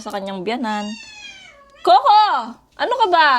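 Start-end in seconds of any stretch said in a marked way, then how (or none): 1.8-3.3 loud, high scolding shout; yelling at a noisy pet dog. Real, not acted. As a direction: snap at the dog to make it stop.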